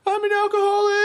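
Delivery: very high, loud hammed-up wail; mock wail. Teen in his head, wailing to himself. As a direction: cry it out in a squeal. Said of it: "in my head, it was instantly like".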